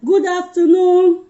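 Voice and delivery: high, very slow, drawn-out hello; sing-song greeting. Real, not acted. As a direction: stretch the greeting like calling across a room. Greeting a viewer on live.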